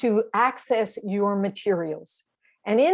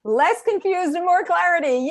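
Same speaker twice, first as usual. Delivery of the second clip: excited echo; 8 semitones higher than usual. Real, not acted. Excited echo of a member's answer.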